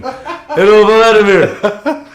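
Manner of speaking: high, loud, drawn-out mock voice with laughter; imitating a game character. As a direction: ham it up like a Call of Duty character, slowly. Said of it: "He sounds like a character in a fucking Call of Duty game".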